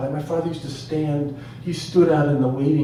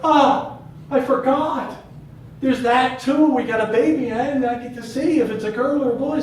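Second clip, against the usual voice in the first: high, loud burst of delight; excited realization; 10 semitones higher, 6 dB louder than usual. Retold or imitated. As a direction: exclaim as it suddenly dawns on you, thrilled. Father suddenly remembering, thrilled.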